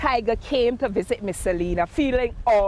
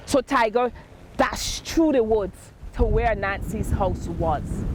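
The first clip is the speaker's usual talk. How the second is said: plain reading voice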